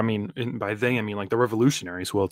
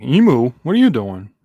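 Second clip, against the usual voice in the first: loud; 8 dB louder than usual. Retold or imitated. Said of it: "Killing him's like".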